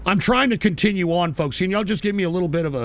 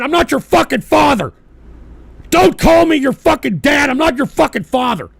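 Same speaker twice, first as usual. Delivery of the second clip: high, loud shouting; real angry outburst; furious rebuke; 6 semitones higher, 7 dB louder than usual. Real, not acted. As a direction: yell back at a teasing chatter. Angrily rejects being called dad.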